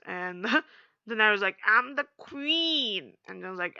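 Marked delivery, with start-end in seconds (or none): 1.7-3.2 slow, higher, lightly voiced queen; haughty queen. Daenerys asserting her title to Jon, slow lightly haughty. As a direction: draw it out like an offended queen.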